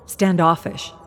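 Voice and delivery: plain reading voice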